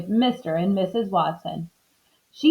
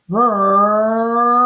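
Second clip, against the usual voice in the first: drawn-out, louder engine vroom; dreaming car noise; 5 dB louder than usual. A narrator's reading. Mister Watson dreaming of driving a fast car, engine vroom. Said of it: "said Mister Watson in his sleep".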